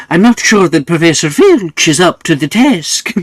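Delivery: loud, high school announcement voice; booming over the intercom. Acted. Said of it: "the intercom noise".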